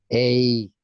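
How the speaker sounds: "Ey" as a loud, drawn-out diphthong; article as "ey". Retold or imitated. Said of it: "Ellos dicen".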